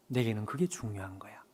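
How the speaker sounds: hushed unvoiced whisper; whispered tenderness. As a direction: whisper it like a secret of love. God's whisper. (속삭이듯 다정하게)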